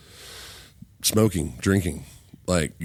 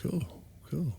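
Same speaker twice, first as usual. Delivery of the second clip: impressed newcomer; 12 semitones higher, 7 dB quieter than usual. Acted. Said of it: "I was like".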